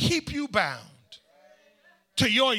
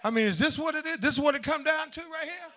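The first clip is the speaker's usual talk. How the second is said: higher, laughing disbelief; incredulous quote; 6 semitones higher than usual. Retold or imitated. Preacher retelling his reaction to boys, amused disbelief. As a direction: play it amazed and half laughing. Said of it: "I'm like".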